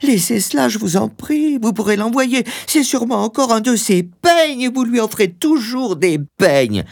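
very high, agitated wife's voice; high-pitched fretful pleading; crying out anxiously. A narrator's reading. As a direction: plead with him to hurry, pitched far up. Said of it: "s'écria-t-elle".

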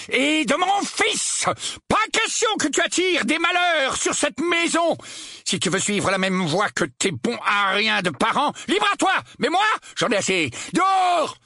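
high-pitched enraged bellow. A narrator's reading. Uncle Vernon ordering Harry out of the house, bellowing. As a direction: rant and roar at the top of the voice. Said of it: "beugla l'oncle Vernon".

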